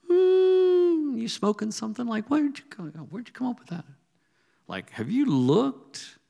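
high, disbelieving mock retort; incredulous retort. His retort to the Lord's word.

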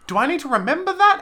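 high, puzzled retold thought; mock confusion. Telling Jake about the opening: high mock puzzled question. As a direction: ask it like you're lost mid-show. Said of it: "it was like".

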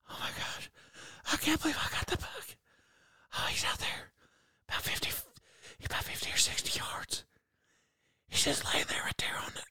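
thrilled whisper to the camera; whisper talking. Retold or imitated. Hunter whispering on a buck video after the shot, thrilled. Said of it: "Quit whisper talking".

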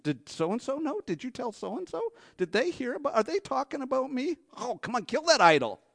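high, anxious fretting; mock fretting. Preacher mimics believers fretting who heard about them. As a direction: fuss like a worried gossip.

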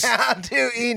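high alien voice echoing. Retold or imitated. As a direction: echo the alien's clueless question. Other host echoes the alien line.

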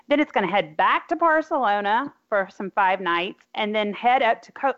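ordinary delivery

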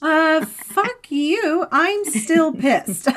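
slow, high, loud mock fury; wife snapping back that she is still angry, slow and mock furious; mock angry retort. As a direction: drag it out, playfully furious. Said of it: "And I'm like".

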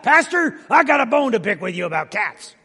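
high, loud complaining parishioner; loud mock-indignant complaint; upset church member. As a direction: play the offended cat lover confronting the pastor. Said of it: "Started getting emails and comments".

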